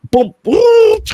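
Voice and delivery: high-pitched boom sound effects; imitated impact noises. Acting out the spider stomp, high noises. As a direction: voice the hits as high cartoonish booms.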